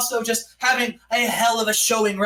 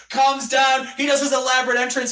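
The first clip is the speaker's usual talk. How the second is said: usual speaking voice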